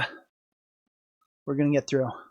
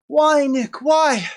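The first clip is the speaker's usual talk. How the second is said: whiny lament; 7 semitones higher, much slower than usual. Real, not acted. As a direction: moan it out to the sky. Lamenting to himself.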